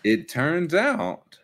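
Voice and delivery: very slow drawn-out reveal; dramatic slow reveal. Real, not acted. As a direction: stretch it for suspense. Dramatic repeat.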